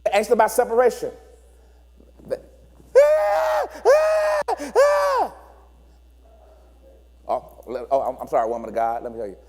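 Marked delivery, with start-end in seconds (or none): 3.0-5.3 put-on high piercing toddler scream; child screaming. Anxious child screaming for a parent. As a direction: wail like an anxious baby.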